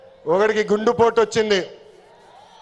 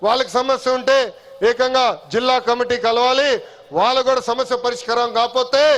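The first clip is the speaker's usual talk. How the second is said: ordinary talk, nothing marked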